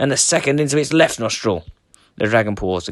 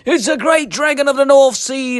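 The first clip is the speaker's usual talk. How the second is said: frightened cry; 13 semitones higher, 5 dB louder than usual. A narrator's reading. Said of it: "they cried".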